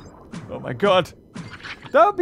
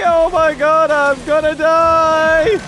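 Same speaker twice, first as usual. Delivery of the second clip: very high, loud squeal of thrill then panic; 7 semitones higher, 6 dB louder, much slower than usual. Real, not acted. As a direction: scream with joy and terror on a ride. Shark leaps out of ocean.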